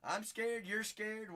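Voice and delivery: ordinary talk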